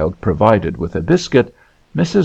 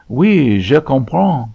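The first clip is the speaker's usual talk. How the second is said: higher, lightly voiced young lady; polite French reply; 4 semitones higher, slower than usual. A narrator's reading. Miss Morland replying to little Hester, polite French answer. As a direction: answer briefly in careful French.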